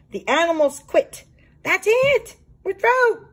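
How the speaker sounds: high, loud triumphant quitting; defiant strike cry. Acted. Zoo critters declaring their strike, high and loud.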